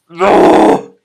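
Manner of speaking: loud high cry of disbelief; loud high shocked reaction. Real, not acted. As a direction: yelp it in alarm. Reader shocked at queen.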